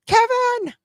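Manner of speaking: high shrieking "Kevin!"; frantic high yell of "Kevin!"; panicked yell. Retold or imitated. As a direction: scream the name like Home Alone parents. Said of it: "they go".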